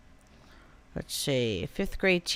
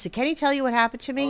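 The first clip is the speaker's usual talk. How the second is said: ordinary talk, nothing marked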